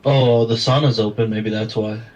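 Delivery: sounding far off in an echoey room; echoing tunnel. Real, not acted. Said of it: "You sound like you're in a tunnel".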